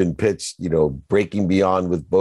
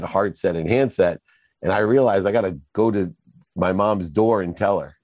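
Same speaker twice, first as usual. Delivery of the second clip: ordinary talk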